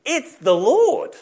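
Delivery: high, loud, drawn-out cry; exclaimed out loud. Acted. John realising who is on the beach, exclaiming out loud. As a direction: call out the sudden realisation slowly. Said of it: "he says it out loud".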